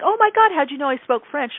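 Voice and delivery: high, astonished retold reaction; mock-astonished client; imitated amazement. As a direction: act out her amazed question, pitched well up. Client amazed at hearing French.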